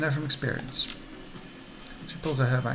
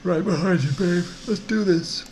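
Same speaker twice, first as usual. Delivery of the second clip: higher, louder voice for an eager sidekick; Paco, game sidekick; 5 semitones higher, 5 dB louder, slower than usual. Acted. Paco backing up a teammate before a raid, higher and louder. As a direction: punch it up as the ready backup.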